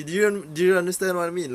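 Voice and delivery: high, loud and fast; excited rush. Real, not acted. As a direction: spill the question out in a rush, pleading to be understood. Excitedly pressing his point.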